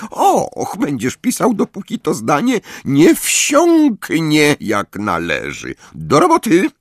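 high, slow chirping sweetness; twittering. A narrator's reading. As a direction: coo it like a smug songbird. Umbridge voiced in narration.

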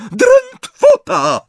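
piercingly high, drawn-out shriek; shrieked spell. A narrator's reading. Bellatrix casts at the next snatcher, shrieking. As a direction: scream the curse at full pitch. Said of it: "wrzasnęła".

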